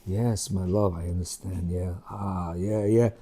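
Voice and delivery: very soft, tender murmured comfort; sympathizing. Retold or imitated. Husband soothing his complaining wife, very soft. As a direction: melt into gentle understanding. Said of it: "when you sympathize with her".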